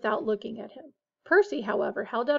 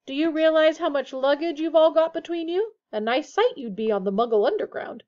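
louder motherly fussing; brisk; 5 semitones higher, 5 dB louder than usual. A narrator's reading. Missus Weasley chides the family about luggage, brisk. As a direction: take charge of the practicalities. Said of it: "said Missus Weasley briskly".

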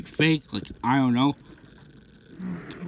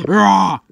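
short loud mock roar, pitched up; attack roar; 5 semitones higher, 8 dB louder than usual. Monster starting the fight with a punch, roaring. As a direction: roar as you lunge.

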